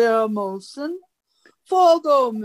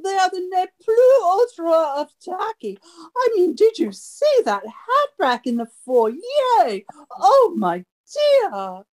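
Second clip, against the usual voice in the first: fast, high, catty society gush; gushing gossip; 5 semitones higher than usual. Acted. Party guest gushing to Greta about the hosts, catty and fast. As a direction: dish the dirt breathlessly.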